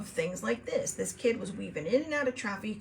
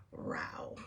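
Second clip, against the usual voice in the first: a soft, unvoiced aside on one word; whispered; 10 dB quieter than usual. Real, not acted. Telling viewers about the roadside row, whispered. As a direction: let the word slip out under your breath.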